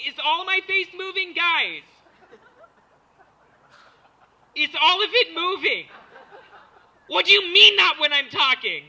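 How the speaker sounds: high, loud hammed-up panic with laughs; mock stoned panic. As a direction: freak out like you're having a stroke. Stoned rapper panicking in interview.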